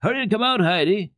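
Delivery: high, loud merry call; bright playful shout from outside. A narrator's reading. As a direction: call her out to play across the yard. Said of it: "as if they were calling to her".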